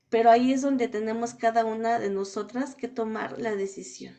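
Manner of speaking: ordinary delivery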